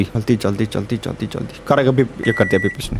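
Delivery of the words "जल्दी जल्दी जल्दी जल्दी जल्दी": softer, fading hurried repeats; hushed urging. Real, not acted. Gamer racing the beeping microwave, hushed urging. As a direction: urge yourself on under your breath. Hurrying before microwave beeps.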